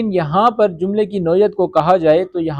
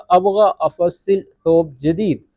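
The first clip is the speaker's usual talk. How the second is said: slow, careful ابغى افصل ثوب جدید; teacher modelling slowly; slow model sentence; slower than usual. Shown as a demonstration. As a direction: dictate the Arabic sentence slowly. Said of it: "یعنی کہ میں نیا کپڑا".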